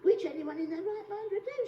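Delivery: usual speaking voice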